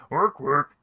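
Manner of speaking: loud grunting peon; orc peon voice. Acted. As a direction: bark it like a game worker. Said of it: "They sound like orc peons".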